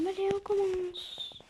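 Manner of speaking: high, drawn-out call; slow high-pitched "let's go"; playful kickoff. Real, not acted. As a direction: stretch it out high to get things started.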